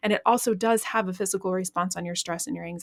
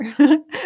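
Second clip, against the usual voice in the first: short laugh; 7 semitones higher, 10 dB louder than usual. Real, not acted. Laughs at her own calm.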